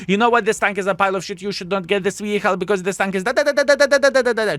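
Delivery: loud, high ranting tirade trailing into da da da; hammed-up angry tank critic; mock critic rant. Pretending to be a harsh critic trashing the tank, loud mock rant. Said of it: "I would say".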